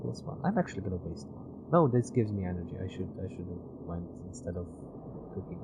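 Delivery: ordinary talk, nothing marked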